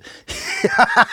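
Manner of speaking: sudden loud high mocking cackle; mocking laugh. Real, not acted. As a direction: laugh right in his face, loud and high. Mocking the opponent live.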